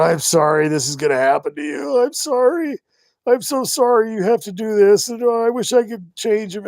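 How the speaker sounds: high, mock tearful apology; imitating bawling. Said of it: "she's bawling her eyes out".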